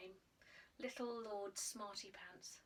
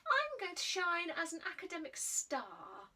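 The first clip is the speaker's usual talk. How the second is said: bright, higher proud boast; proud boy; 6 semitones higher, 4 dB louder than usual. A narrator's reading. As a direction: puff up with pride. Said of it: "talking to himself out loud".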